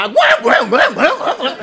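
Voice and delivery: high, loud repeated pig squeals. Acted. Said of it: "un cochon qui a crié". (kochon ka kriyé)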